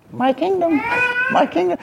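high sung-out call of my kingdom; God calling out. Retold or imitated. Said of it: "God's over here going".

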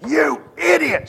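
high, loud yell, slowed down; retold angry shout. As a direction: bark the insult across the room. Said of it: "so I just heard this".

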